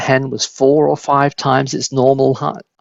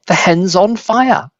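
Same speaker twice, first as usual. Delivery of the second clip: high, slow alarmed cry; grandma yelling at the burning hen; 6 semitones higher than usual. A narrator's reading. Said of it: "Grandma yelled".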